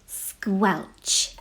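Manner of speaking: plain reading voice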